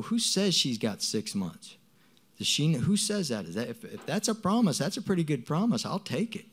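high-pitched, incredulous questioning; challenging, retold. Disputing her six months as a promise, challenging.

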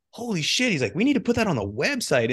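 an awestruck boss seeing the numbers; excited boss. Retold or imitated. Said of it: "he was like".

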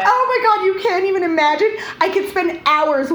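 high, loud excited gush. Real, not acted. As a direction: gush with delight. Gushing about eating videos.

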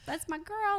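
lightly voiced husband, pitched well up; imitated doting husband. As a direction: quote the proud dad in a high voice. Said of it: "So right now he's like".

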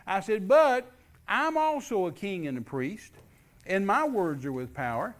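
preacher decreeing over the plant closing; forceful preaching. Retold or imitated. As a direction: declare it with full authority.

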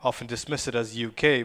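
normal talking voice

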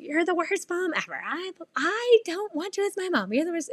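high-pitched mock screaming tantrum; retold child's yelling. Upset daughter screaming at her mom in her room, imitated yelling. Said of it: "she was yelling and screaming at me".

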